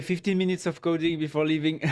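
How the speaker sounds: normal talking voice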